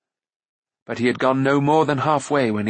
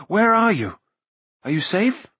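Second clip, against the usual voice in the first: high, urgent questions; crying out; 9 semitones higher, 3 dB louder than usual. A narrator's reading. Searcher to lost prince, urgently crying out. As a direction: call out anxiously, pitched high. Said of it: "Taran cried".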